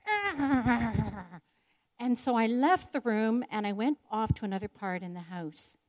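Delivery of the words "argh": frustrated growl. Retold or imitated. Acting out her frustration.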